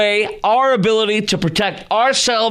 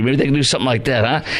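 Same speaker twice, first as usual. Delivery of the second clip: normal talking voice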